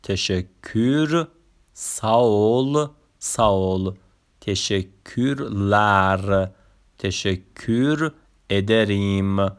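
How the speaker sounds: each Turkish word said slowly and clearly, تشکر, ساول, ادریم; pronunciation review. Shown as a demonstration. Teacher repeats Turkish thanks words for learners to copy. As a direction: read the list back slowly.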